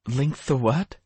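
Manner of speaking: Hope's voice. Acted. Hope confused, character voice.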